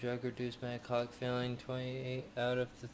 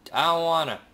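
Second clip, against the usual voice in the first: loud, higher protesting wail; loud whining refusal; 4 semitones higher, 12 dB louder than usual. Real, not acted. Player refusing the game's dirty work, loudly protesting. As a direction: holler your reluctance.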